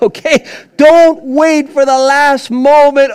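a pastor urging dads not to wait, preached loud and slow; forceful preaching. Acted. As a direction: hammer the warning home to the fathers.

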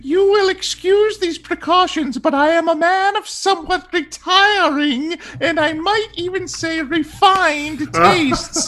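high, precious Mickey Mouse voice; high squeaky voice. Pretend. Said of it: "Give it to us in your best voice".

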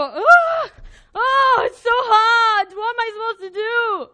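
loud, high, drawn-out wail; mock despair. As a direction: cry out in hammed-up helplessness. Acting out despair when life hits.

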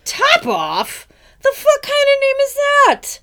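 high, loud shocked squeak of disbelief; outraged mouse. Retold or imitated. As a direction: play the mouse yelping in outrage. Mouse outraged at baby name.

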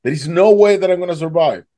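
high, exasperated pleading; desperate protest. Retold or imitated. Drowning man argues with the voice, desperate and high.

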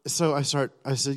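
ordinary talk

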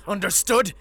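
high, loud demand; a furious father demanding an answer; sharp demand. Acted.

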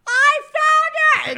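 very high, drawn-out mock scream; piercingly high retold cry, not full volume; imitated screaming. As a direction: squeal it as if heard two blocks away. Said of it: "And just screaming".

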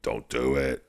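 low, soft warning; lightly voiced low warning; low pleading darkness. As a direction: murmur it like a voice in his head. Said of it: "the darkness is like".

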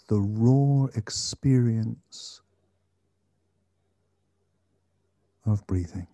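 slow, calm meditation guide; guided meditation. Acted. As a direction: gently guide attention to the breath.

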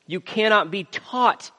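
loud, high, emphatic preaching, building in force; forceful preaching. Real, not acted. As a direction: drive each point home to the congregation.

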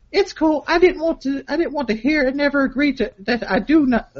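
high-pitched put-on girlfriend voice; hammed-up girly texting voice. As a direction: read it in a mock-girlish falsetto. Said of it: "a girly voice for this part".

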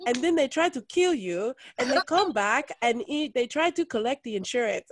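loud, high retelling of mom's dire warning; exasperated mimicry of a worried mother; mock-alarmed mom warning. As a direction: imitate mom laying out a lurid scheme.

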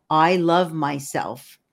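normal talking voice

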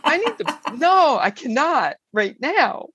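high, halting refusal; stammer, then refuse emphatically in mock panic; retold panic. Driver putting off the friend's call, panicked. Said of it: "I had such a panic attack".

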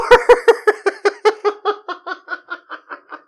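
high, loud cackle fading out; real laughing fit, high and rapid; long cackle. Real, not acted. Cracks up at own remark.

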